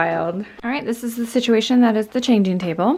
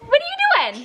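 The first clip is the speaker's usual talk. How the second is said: playful high voice; much faster than usual. Real, not acted. Mom playfully calls to toddler.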